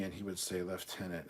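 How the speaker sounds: usual speaking voice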